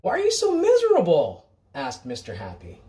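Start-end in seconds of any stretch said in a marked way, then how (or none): 0.0-1.5 much higher, louder bright voice; cheery question. Acted. Mister Happy asks the sad stranger, high and bright. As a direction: ask it brightly, puzzled.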